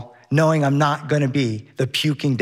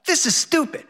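higher, lightly voiced whiny kid; child whining; 7 semitones higher than usual. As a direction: whine like a let-down little boy. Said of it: "Henry whines".

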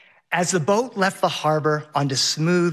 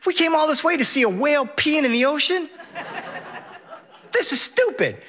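high-pitched imitation of an indignant child; mock kid whine, thoroughly unimpressed; whining kid; 11 semitones higher than usual. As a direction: complain like a let-down little boy. Whiny child voiced in story.

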